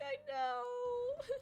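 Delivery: very high teary whine; mock crying child. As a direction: squeak it out like a sobbing kid.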